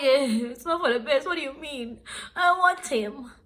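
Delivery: high, loud whiny protest; mock wailing. Heartbroken caller protesting to friend that she wants him, mock wail.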